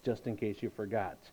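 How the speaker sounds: normal talking voice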